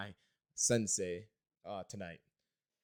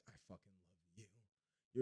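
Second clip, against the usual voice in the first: barely audible, halting gush; hushed adoration; 6 semitones lower, 18 dB quieter, much faster than usual. Retold or imitated. Customer given coupons by her, hushed adoring aside. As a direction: murmur it very softly, word by word. Gushing inward reaction to coupon gift.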